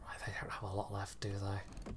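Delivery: unvoiced hushed aside; whispering. Real, not acted. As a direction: whisper it to yourself. Whispers in gameplay.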